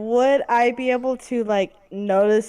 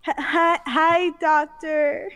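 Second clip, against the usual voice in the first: shy cat greeting; 7 semitones higher, slower than usual. Acted. Cat character greets shyly.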